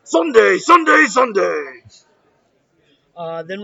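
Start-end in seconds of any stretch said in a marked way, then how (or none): 0.0-1.8 booming announcer shout, slow and loud; monster truck ad. Retold or imitated.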